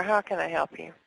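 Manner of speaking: normal talking voice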